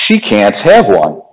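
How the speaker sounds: low, slow, measured refusal; Mister Wonka answering. A narrator's reading. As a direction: lay down a firm, unhurried no. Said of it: "Mister Wonka answered".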